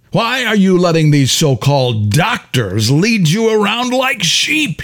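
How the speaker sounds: rasping, high-pitched scorn; raspy voice. A narrator's reading. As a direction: rasp out the sneer at her. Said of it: "he rasped".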